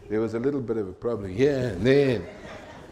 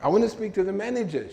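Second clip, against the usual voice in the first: higher and fast, a mock angry daughter; furious demand; 9 semitones higher, 3 dB louder, faster than usual. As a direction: snap out the demand to the clerk. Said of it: "So she was furious".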